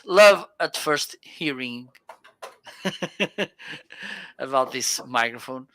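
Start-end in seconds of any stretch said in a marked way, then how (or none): 2.4-4.3 light, higher-pitched chuckle; a run of quick giggles; laugh. Real, not acted. Laughs at own love-at-first-hearing joke.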